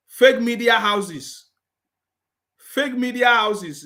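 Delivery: slow, stressing fake. Real, not acted. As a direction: drag out "fake media houses" with weight on fake. Slow mocking repeat.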